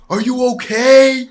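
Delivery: high loud worried yell; screaming. Retold or imitated. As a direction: shout it into his ear. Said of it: "I'm screaming in his ear".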